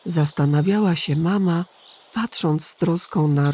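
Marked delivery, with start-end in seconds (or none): none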